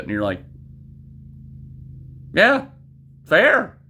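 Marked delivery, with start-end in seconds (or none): 2.3-3.8 high mock agreement.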